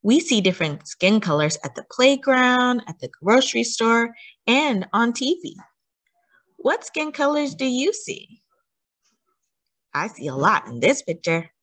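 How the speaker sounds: put-on gentle picture-book read-aloud with questions to the child; a touch of storyteller warmth, mostly natural voice; reading to children. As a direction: read it like bedtime story time for a small listener.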